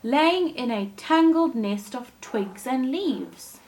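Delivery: gentle storybook reading voice; storyteller reading a fairy tale to kids; story narration. A narrator's reading. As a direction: read it like a bedtime story for children. Reads Thumbelina story aloud.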